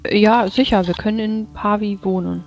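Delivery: high character voice. Acted. Character voice agreeing.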